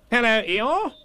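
high, brisk greeting; chirpy animal character hello; Rabbit. A narrator's reading. Rabbit greets Eeyore brightly.

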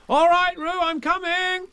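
high, loud rescuing call; boy calling to Roo as he runs. Acted. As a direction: shout across the water. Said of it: "called Christopher Robin".

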